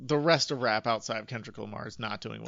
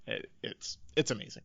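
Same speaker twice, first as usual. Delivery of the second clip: ordinary delivery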